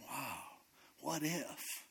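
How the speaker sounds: soft, pensive musing; hushed wondering. Acted. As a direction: let the possibility sink in softly. Gideon quietly starts to consider it.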